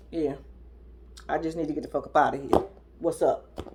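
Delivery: low, soft, offhand; indifferent mock reply. Man brushing off his crying girlfriend, indifferent. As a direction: play him unbothered, low and under the voice.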